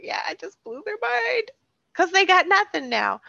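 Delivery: high mock-gloating boast, lightly done; gloating inner voice. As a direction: crow over the win in your head. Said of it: "like, the evil laugh because I was like".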